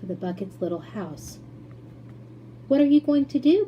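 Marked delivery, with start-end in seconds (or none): 2.7-3.7 high, loud worried question; anxious cry. A narrator's reading. Charlie crying out to Mister Wonka in alarm. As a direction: Charlie alarmed at what's coming. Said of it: "cried Charlie".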